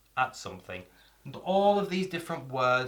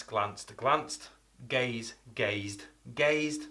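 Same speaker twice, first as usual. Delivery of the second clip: ordinary delivery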